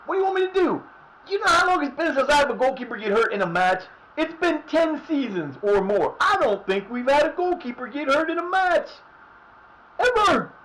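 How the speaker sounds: very high, loud outraged rant; disbelieving yell. Real, not acted. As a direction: shout in disbelief at the injury. Outraged by the injury.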